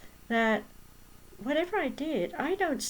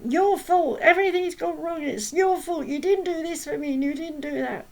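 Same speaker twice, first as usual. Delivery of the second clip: loud, high, accusing outburst; angry blaming; 7 semitones higher, 6 dB louder than usual. Retold or imitated. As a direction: yell the blame straight at them. Nasty person blaming the speaker.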